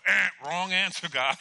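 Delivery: loud mock game-show buzzer, then the verdict; wrong-answer buzzer. Patient wanting to reject God's answer, mock buzzer. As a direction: blurt a loud buzzer noise in protest. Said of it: "I wanted to go".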